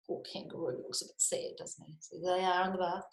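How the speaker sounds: ordinary delivery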